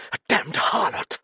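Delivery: high, loud, iron-hard snarl; angry snarl. A narrator's reading. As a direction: spit it out in bitter anger. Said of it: "She snarled the word with the iron anger".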